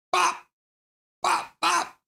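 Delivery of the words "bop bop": high cartoon "bop bop"; squeaky punch sounds; punch sound effect. Shown as a demonstration. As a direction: make punching noises. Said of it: "This is how I punch".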